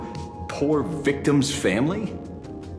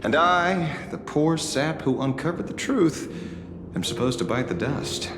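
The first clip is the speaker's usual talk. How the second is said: mock self-pity; 8 semitones higher than usual. Mocking himself as the victim.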